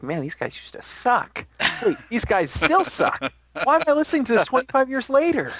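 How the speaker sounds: high-pitched put-on voice of a complaining listener; disgruntled listener. Co-host imagines a listener decades on, high mock complaint. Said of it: "you'll be like".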